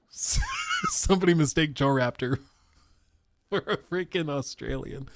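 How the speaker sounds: real laughter breaking into the words; cracking up. Real, not acted. Streamer recalls friend mistaken for Australian, cracking up. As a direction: struggle through the giggles.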